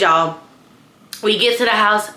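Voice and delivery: normal talking voice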